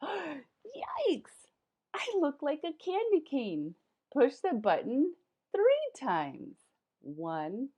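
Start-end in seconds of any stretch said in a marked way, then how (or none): 0.0-3.8 high startled yikes; hammed-up fright at being striped; shocked yikes. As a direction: squeal it in mock alarm.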